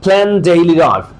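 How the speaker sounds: slow "Plan daily life", no d before daily; d dropped in planned. Shown as a demonstration. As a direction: merge "planned daily" into one d, slowly. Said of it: "এখানে প্ল্যান্ড আছে না". (ডি বাদ দিয়ে ধীরে "Plan daily life")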